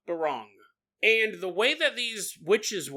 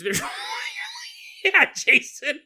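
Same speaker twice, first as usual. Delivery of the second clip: high shrieking laugh, then louder; 5 dB louder than usual. Real, not acted. Laughing hard at Jason about the bug. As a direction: squeal with laughter, then call out the name.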